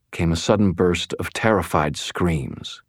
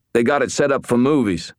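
gruff cop voice; 8 semitones higher, 4 dB louder than usual. Acted. Rollins answering, tense.

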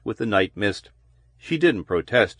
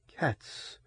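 shaky unvoiced whisper; trembling whisper; 3 semitones higher, 14 dB quieter than usual. A narrator's reading. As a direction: whisper it, voice shaking. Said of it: "Loris whispered shakily".

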